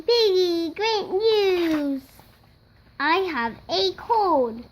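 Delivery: ordinary delivery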